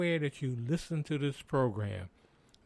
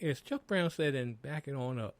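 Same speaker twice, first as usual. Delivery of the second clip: ordinary delivery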